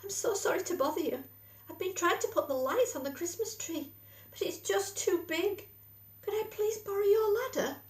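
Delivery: high, fast, apologetic little voice; timid mole. A narrator's reading. Mole asking Badger to borrow his ladder, timid. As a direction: rush the apology, nervous and small. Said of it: "he said timidly".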